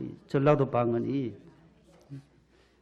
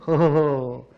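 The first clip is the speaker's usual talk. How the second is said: hearty chuckle; 5 dB louder, much slower than usual. Acted. 충청도 낭독 중 웃음.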